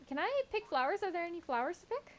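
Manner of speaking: high, hopeful asking; eager, high. Real, not acted. Eager question to NPC.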